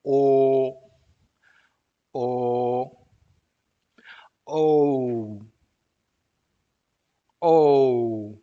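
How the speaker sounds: O repeated as a pure vowel, jaw kept still; pure O drills. Shown as a demonstration. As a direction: drill O with no glide, holding the vowel. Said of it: "Let's try it".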